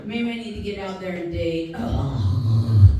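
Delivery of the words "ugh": low disgusted groan. Acted. Groan at dating.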